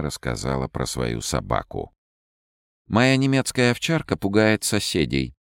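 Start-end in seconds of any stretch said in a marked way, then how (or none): 2.9-5.3 bright raised voice telling a joke; higher, playful woman's voice; lively young woman. A narrator's reading. Ольга рассказывает про собаку. (весёлый девичий голос)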